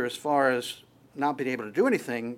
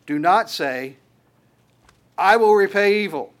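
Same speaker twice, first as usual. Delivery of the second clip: "Do not say, 'I will repay evil'" read out louder and higher; loud scripture quote; 5 semitones higher, 9 dB louder than usual. Shown as a demonstration. Preacher reading the proverb aloud, raised and forceful. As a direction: proclaim the verse with force. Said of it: "that old bumper sticker".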